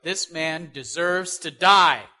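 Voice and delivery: loud, slow, heavy condemnation; angry verdict. Retold or imitated. King David condemning the rich man, loud slow angry verdict. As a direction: pronounce the sentence slowly and loudly. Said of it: "David's angry".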